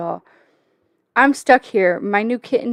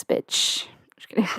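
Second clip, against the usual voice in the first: softly whispered jab then retreat; whispered tease; 7 dB quieter than usual. Real, not acted. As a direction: hiss the insult then whisper it away. Teasing a moderator, then backing off.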